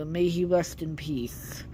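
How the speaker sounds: soft, slow reverent whisper. Real, not acted. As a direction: breathe it out quietly in respect. Reverent aside about Stan Lee.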